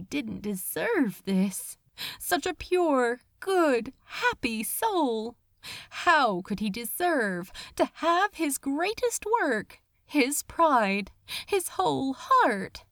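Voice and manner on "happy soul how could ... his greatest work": usual speaking voice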